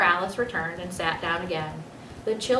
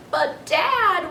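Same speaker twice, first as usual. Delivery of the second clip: put-on high, louder childish whine; whining child; 11 semitones higher, 5 dB louder than usual. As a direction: whine like a kid who wants to keep playing. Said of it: "Willie whined".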